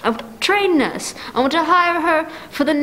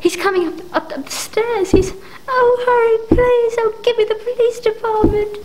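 frantic, tearful begging; crying in terror; 8 semitones higher than usual. Acted. Invalid hears killer on the stairs, crying in terror.

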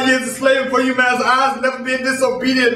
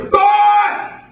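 master yelling; 10 semitones higher, much slower than usual. Acted. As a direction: bark it like a furious slave master. Master yelling at slave boy.

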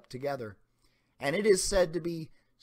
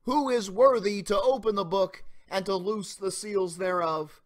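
raised, ringing call of an angel; loud proclamation; 5 semitones higher, 4 dB louder than usual. A narrator's reading. Preacher voicing the strong angel's call to all heaven, loudly proclaimed. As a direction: proclaim it out over the hall. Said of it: "proclaiming with a loud voice".

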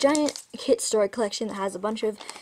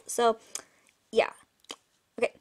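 plain reading voice

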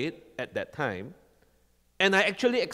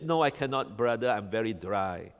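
lower, softer, tired whine of a dry believer; mock weary believer; 7 semitones lower, 6 dB quieter than usual. Preacher mimicking a weary believer's excuses, lower and softer. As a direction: play the worn-out believer making excuses.

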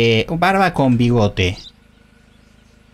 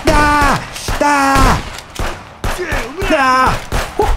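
effort shouts; 13 semitones higher, much slower than usual. Real, not acted. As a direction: bark each one with effort. Swinging an axe in a fight.

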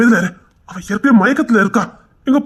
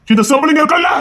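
proud shout; 7 semitones higher than usual. Acted. Proud declaration.

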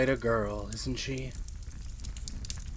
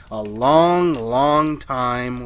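exasperated; 8 dB louder, much slower than usual. Acted. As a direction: wail it in exasperation. Complaint about jealous wife.

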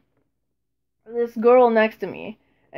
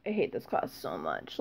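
bored; 15 dB quieter than usual. Retold or imitated. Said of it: "they're sitting here like".

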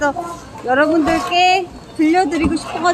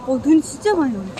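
usual speaking voice

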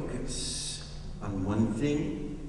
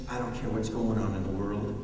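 calm inner resolve; 6 semitones lower, 6 dB quieter, faster than usual. Pretend. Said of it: "that says".

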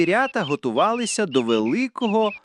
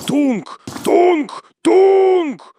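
loud, high hooted stomps; heavy footstep noises called out high; stomping creature chant; 13 semitones higher, 6 dB louder, much slower than usual. A narrator's reading. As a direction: boom each beat, growing louder and longer. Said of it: "і бурмотів".

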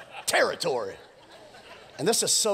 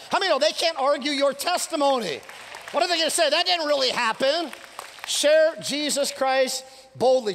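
high, loud preacher's call; rally the congregation to share boldly; fired-up preaching; 7 semitones higher, 3 dB louder than usual. Acted. Pastor rallying the church to share their faith, preaching hard. Preacher building to full force.

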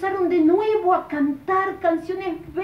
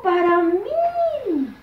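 put-on delighted boy; 4 semitones higher, 6 dB louder, much slower than usual. Boy receives a Bible gift.

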